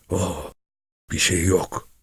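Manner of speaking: soft, hushed reassurance; lightly whispered character line. A narrator's reading. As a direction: say it quietly, close to a whisper. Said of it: "diye fısıldadı".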